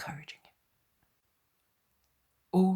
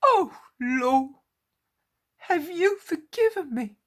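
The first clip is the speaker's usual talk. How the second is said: much higher, louder pleading tremble; trembling voice; 12 semitones higher, 12 dB louder than usual. A narrator's reading. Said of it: "asks Peter in a trembling voice".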